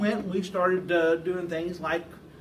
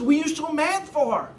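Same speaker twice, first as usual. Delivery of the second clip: high, loud mock alarm; hammed-up bewildered cry; startled question; 9 semitones higher, 6 dB louder than usual. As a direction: play a friend taken aback by an outburst. Friend reacts to the outburst.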